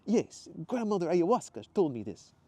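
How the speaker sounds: high, loud Italian insistence; Italian accent. Acted. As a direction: confirm it emphatically in an Italian accent. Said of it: "his faded Italian accent".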